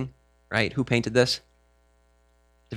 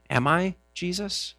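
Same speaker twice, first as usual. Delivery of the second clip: soft, slow, high question; timid plea for reassurance; unsure; 6 semitones higher, 7 dB quieter than usual. Acted. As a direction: ask it hesitantly, voice lifted. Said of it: "they're unsure of themselves".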